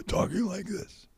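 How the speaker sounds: gasp, then a high, slow strained rasp; mock laryngitis croak, slowly forced out. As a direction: imitate talking with no voice left. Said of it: "I lost my voice with laryngitis".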